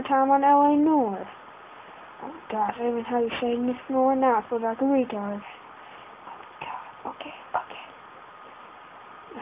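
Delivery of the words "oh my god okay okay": slow, breathy whisper of panic; panicked whisper. Real, not acted. Gamer panicking, whispering while drowning in game. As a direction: whisper it, trying to calm down. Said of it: "Kinda panicking here".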